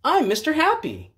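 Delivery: cheery Mister Happy. Acted. Mister Happy introduces himself, high and cheerful. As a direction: sing out your name brightly.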